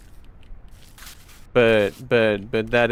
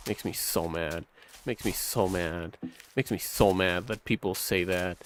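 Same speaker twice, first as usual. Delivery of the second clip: quiet seething; 5 semitones lower, 10 dB quieter than usual. Real, not acted. Repeated seething complaint.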